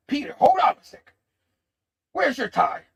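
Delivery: high, loud retold holler; boss calling out. Jonah stops Peter to ask about his tie, hollering. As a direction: call after him like a demanding boss.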